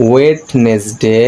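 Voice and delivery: ওয়েডনেসডে as slow "wet-nes-de"; wrong pronunciation. Shown as a demonstration. Teacher showing what to avoid, wrong pronunciation. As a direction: spell out ওয়েডনেসডে slowly in three syllables.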